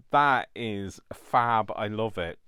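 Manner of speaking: loud, slow, delighted; thrilled. Real, not acted. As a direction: savour it with joy. Thrilled with result.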